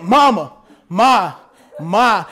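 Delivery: low, drawn-out calls for mom; child calling Mama. Retold or imitated. Preacher imitating his nephew calling for his mother, low and repeated. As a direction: call mom over and over like a kid. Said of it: "One thing that he been doing".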